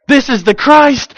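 loud, high, slow proclamation; proclaiming. Pretend. John the Baptist proclaiming Jesus at baptism, loud and slow. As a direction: announce it with certainty.

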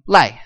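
Lai falling sharply; fourth tone lai. Shown as a demonstration. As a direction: drop Lai down hard. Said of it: "the fourth one".

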